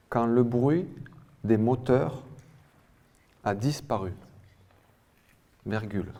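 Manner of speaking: "Quand le bruit", "des moteurs", "a disparu" with long pauses between; "bruit" and "moteurs" rising, "a disparu" falling, well separated; chunked dictation. Real, not acted. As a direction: repeat it piece by piece, pausing after "bruit" and "moteurs". Dictation repeated in small chunks.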